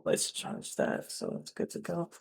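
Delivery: usual speaking voice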